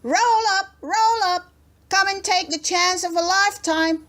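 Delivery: a farmer shouting from his trap. A narrator's reading. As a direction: bellow to draw the crowd. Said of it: "started shouting to attract attention".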